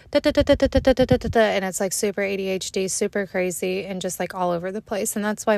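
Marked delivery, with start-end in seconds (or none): 0.1-1.4 fast high "da da da" chatter; rapid high babble. Shown as a demonstration. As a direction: rattle off da-da-da like nonstop talk. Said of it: "I will, like".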